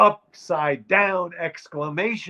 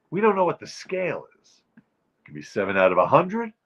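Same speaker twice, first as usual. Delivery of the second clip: ordinary talk, nothing marked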